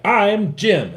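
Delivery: high, loud pretend greeting; mock self-introduction. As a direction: act out a stranger announcing himself. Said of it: "come up and be like".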